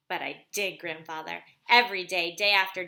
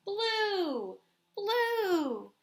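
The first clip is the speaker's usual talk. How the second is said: high, bursting with discovery; joyful cry; 10 semitones higher than usual. Acted. Blind boy discovering blue, exclaiming with joy. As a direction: cry out the colour in wonder.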